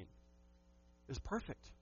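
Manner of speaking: breathy whisper, drawn out slowly. Real, not acted. Pastor to his church about Eden, in a slow whisper. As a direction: let it fall to a hushed, slow whisper.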